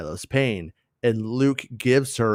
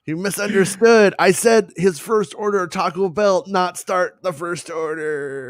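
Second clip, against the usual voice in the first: high, loud put-on Leia; dying Leia voice; 10 semitones higher, 7 dB louder than usual. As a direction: correct them in a shrill Leia voice. Leia voice correcting.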